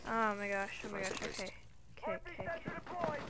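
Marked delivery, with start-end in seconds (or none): none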